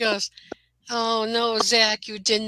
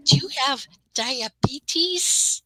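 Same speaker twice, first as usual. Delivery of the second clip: TV lady voice; 5 semitones higher, much slower than usual. Retold or imitated. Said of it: "she's like".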